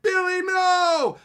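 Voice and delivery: high, loud, stretched-out wail of grief; hammed-up howl over a fallen son; anguished cry. Father watching his kid die, hammed-up anguished wail.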